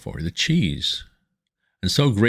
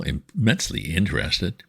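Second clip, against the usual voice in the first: ordinary delivery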